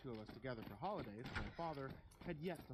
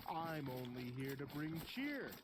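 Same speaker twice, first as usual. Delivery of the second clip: creepy Santa; 4 semitones higher, 4 dB louder, slower than usual. Acted. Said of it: "chuckled to himself".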